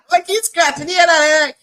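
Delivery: high, loud laughing aside. Real, not acted. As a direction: laugh right through the words. Joking aside while laughing.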